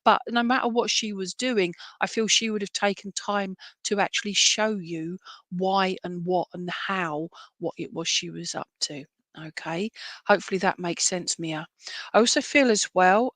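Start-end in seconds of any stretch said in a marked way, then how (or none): none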